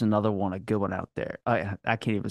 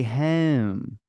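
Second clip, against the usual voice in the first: very slow Baltimore "home", close to "hem"; drawn-out Baltimore accent; Baltimore long O. Shown as a demonstration. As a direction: stretch and front the O in home, Baltimore style. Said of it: "it's just the long O".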